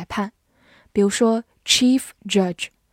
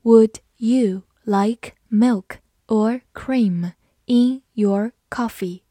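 slow, careful question; slow reading; 3 dB louder, much slower than usual. Shown as a demonstration. Teacher reading the question slowly for students. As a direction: read it slowly, word by word. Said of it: "好，我们慢慢来读。".

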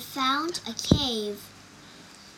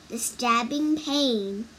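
ordinary delivery, nothing marked

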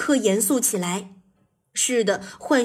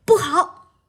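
high, loud cry of alarm; alarmed shriek; 7 semitones higher, 5 dB louder than usual. A narrator's reading. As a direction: shriek a sudden warning. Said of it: "彼得兔大声尖叫道". (大声尖叫)

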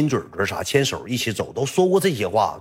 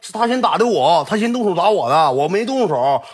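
higher-pitched, insistent excuse; defensive protest; 7 semitones higher than usual. Retold or imitated. Bully explaining the fight to his grandfather, defensive. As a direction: plead your case in a raised pitch.

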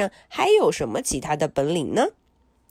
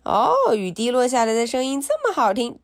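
plain reading voice

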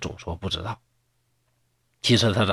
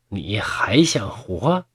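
slow, hushed threat; menacing whisper; 3 semitones lower, much slower than usual. Acted. Fox spirit threatening the scholar in a slow whisper. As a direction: breathe the threat out slowly. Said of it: "生气地说".

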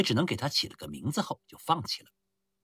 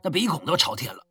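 muffled booming voice. Acted. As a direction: speak thick and hollow from the chest. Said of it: "瓮声瓮气地开口说道". (瓮声瓮气的低沉嗓音)

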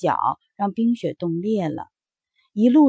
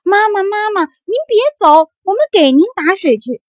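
much higher, louder promise to fetch water; children yelling after her, begging; children chasing the bird, shouting they will fetch water; pleading shout; 12 semitones higher, 10 dB louder than usual. Acted. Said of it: "孩子们一边追一边喊".

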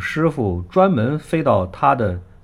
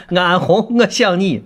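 put-on Shaanxi accent; 7 semitones higher, 4 dB louder than usual. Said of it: "有一天要学陕西话的时候".

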